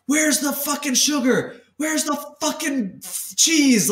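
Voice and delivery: high-pitched screaming devil on your shoulder; yelling devil. Acted. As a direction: yell it high and urgent, demanding a fix. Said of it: "the devil's s-, yelling in your ear".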